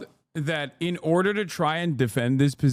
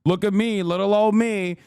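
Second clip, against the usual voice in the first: put-on higher, whiny little-old-me voice; mocking a state pleading for sympathy; mock self-pity; 4 semitones higher than usual. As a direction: play the pitiful victim state, hammed up. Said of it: "and be like".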